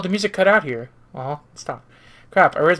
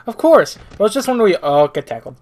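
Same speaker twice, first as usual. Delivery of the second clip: ordinary talk, nothing marked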